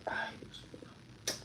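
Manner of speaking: soft, slow whisper of dismay; whispered worry. Real, not acted. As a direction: breathe it out under your breath, worried. Worried after hitting the pet.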